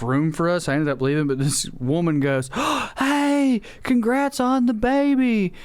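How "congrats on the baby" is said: ordinary talk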